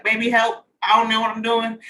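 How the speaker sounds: a hammed-up cry for help; mock helpless plea. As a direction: play it lost and begging your partner. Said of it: "I'll be like".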